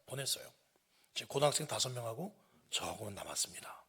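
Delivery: ordinary delivery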